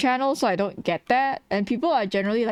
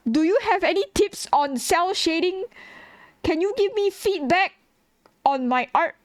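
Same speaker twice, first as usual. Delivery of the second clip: high, whiny pestering voice; mocking chat questions; 8 semitones higher than usual. Streamer mimicking viewers pestering for art tips in a high whiny voice. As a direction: parrot annoying viewers asking for tips. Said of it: "people come in here and be like".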